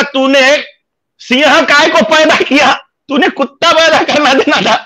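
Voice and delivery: high-pitched acted protest to mother; indignant retort. Son retells answering his mother back, in high mock indignation.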